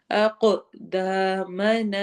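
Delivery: اقدامنا very slow, with an echoing bounce on the ق and a long دا; tajweed demo. Shown as a demonstration. Teacher carefully chants اقدامنا, very slow and recited. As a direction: recite اقدامنا with qalqala on the ق, stretching the الف. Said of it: "قاف پہ again قلقلہ ہوگا".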